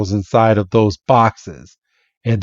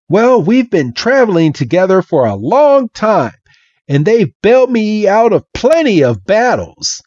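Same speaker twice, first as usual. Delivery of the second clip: put-on high boyish Red; 10 semitones higher than usual. Red proudly explaining.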